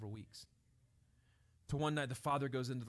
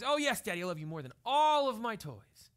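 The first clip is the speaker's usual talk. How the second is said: higher and louder, bursting with yes; eager little girl; 8 semitones higher, 11 dB louder than usual. Acted. Daughter eagerly telling her dad she loves him. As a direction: blurt it out like an eager child.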